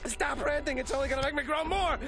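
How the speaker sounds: high, urgent self-scolding; frantic yelling. Acted. Man ordering himself to stop ranting, frantic yelling. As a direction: snap an order at yourself, high-pitched. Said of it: "Don't yell at me!".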